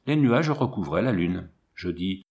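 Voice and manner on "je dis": usual speaking voice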